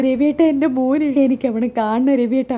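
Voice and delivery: acted wailing plea to a husband; hammed-up weepy appeal; tearful begging. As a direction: plead like a distraught mother. Mother begs to see injured son.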